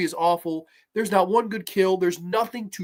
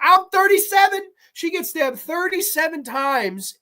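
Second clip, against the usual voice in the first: incredulous shout; 8 semitones higher, 4 dB louder than usual. Real, not acted.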